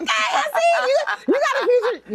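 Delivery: high, laughing mock praise; cackling tease; laughing shout. Real, not acted.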